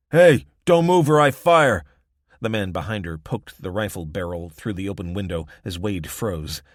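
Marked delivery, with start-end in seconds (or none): 0.1-1.8 loud barked warning; shouted threat. A narrator's reading. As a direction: yell it at gunpoint. Gunman threatening.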